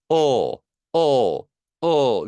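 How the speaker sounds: long, held "어", said with force; resonant "어" demo. Shown as a demonstration. Teacher showing learners how to make the sound ring, resonant "어" demo. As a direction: sound out a drawn-out, ringing "어". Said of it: "이렇게 울려주면 돼요".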